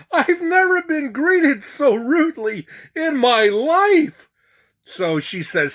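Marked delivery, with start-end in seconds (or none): 0.1-4.3 high-pitched, hammed-up affront; mock indignation. Passenger insulted by flight attendant, mock indignation. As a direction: squeal it, comically offended.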